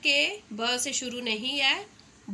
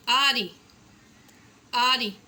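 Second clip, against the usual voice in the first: louder, well-projected आरी; loud and clear; 6 dB louder, slower than usual. Shown as a demonstration. Teacher saying words aloud for children, loudly. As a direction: call the word out for the class. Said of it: "बुलंद आवाज़ में बताना है".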